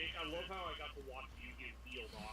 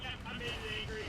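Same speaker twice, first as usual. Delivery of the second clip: excited rush; 8 semitones higher, 5 dB louder, faster than usual. Real, not acted. Monster enraged mid-fight.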